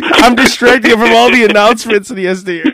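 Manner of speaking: ordinary delivery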